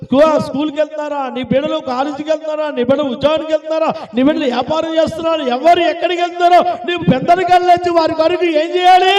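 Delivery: driving string of preacher's questions; rapid-fire preaching. Real, not acted. (జోరుగా ప్రశ్నల వర్షంతో ప్రసంగం)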